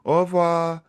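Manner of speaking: Bonjour and Au revoir high and lilting; sing-song French. Shown as a demonstration. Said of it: "they sing everything".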